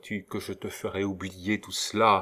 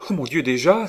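high, loud startled exclamation; shocked, anguished outburst; dismayed cry; 7 semitones higher, 7 dB louder, faster than usual. A narrator's reading. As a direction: cry out in alarm at the interruption. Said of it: "s'écria Hélène".

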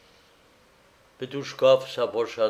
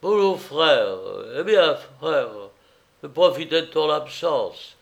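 tipsy, matey slur, pitched up; loud, higher drunk voice; drunken slurring; 4 semitones higher, 6 dB louder than usual. A narrator's reading. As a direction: greet a pal with sloppy consonants. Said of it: "en prononçant les consonnes d'une manière fort peu intelligible".

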